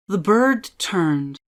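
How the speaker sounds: plain reading voice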